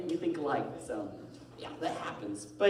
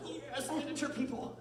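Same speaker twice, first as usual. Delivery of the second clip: mock delight; 10 semitones higher than usual. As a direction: savour it in a high, excited voice. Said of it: "I'm like".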